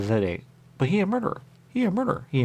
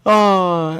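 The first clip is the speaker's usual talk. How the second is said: normal talking voice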